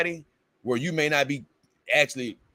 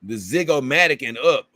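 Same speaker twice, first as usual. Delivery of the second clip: usual speaking voice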